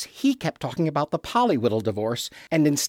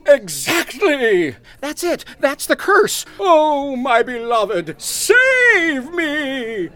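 hammy stage plea; 7 semitones higher, much slower than usual. Acted. Egbert to his fiancée Doris, ham actor's plea. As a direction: throw every line to the back row.

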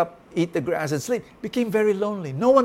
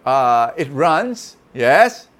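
louder, eager guessing; horse guessing; 2 semitones higher, 10 dB louder, slower than usual. A narrator's reading. Horse guesses aloud what a horse does. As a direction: list answers, pressing on. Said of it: "And the horse said".

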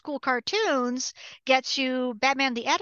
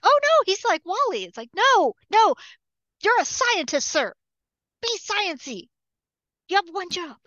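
exasperated scolding; 6 semitones higher than usual. Retold or imitated. Scolding writers about Barry.

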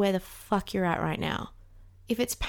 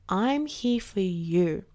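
tender comfort; much slower than usual. Pretend. She comforts her frightened inner child, slow and tender. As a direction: hold her close with your voice.

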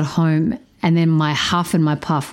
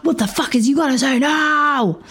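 high, put-on mock aggression; mock angry; 8 semitones higher than usual. Mocking her angry text to her partner, higher. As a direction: bark it in a comic tough voice. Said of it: "I was all fucking angry".

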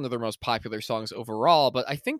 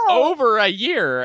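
excited; 11 semitones higher, 10 dB louder than usual. Real, not acted. Gushing about long wait for song.

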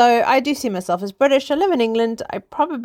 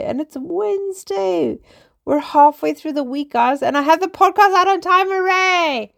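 cheerful hooray; 10 semitones higher, 3 dB louder than usual. Real, not acted. Cheering podcast out on time.

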